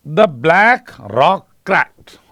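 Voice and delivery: very slow, each word hit separately; slow demo reading. Shown as a demonstration. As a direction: read it word by word, stressing the k endings. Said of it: "अब आपको इसमें देखना है". (धीरे-धीरे, हर शब्द के अंत की क ध्वनि पर ज़ोर)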